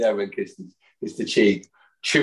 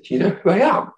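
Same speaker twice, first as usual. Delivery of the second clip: much higher, incredulous retold question; retold starstruck disbelief; 12 semitones higher, 4 dB louder than usual. Fan asks idol's wife, high amazed voice. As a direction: ask it high, in mock amazement. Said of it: "I said".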